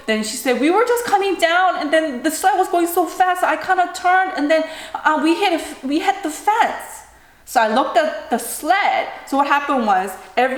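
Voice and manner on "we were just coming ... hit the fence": put-on high, upset kid voice; retold child explaining. Retells daughter's panicked explanation.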